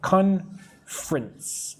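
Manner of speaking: "Conference" drawn out slowly as two syllables, CON-frence. Shown as a demonstration. Teacher demonstrating the syllable count, "Conference" in two slow syllables. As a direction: say "Conference" slowly, dropping the middle vowel. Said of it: "it's more like two".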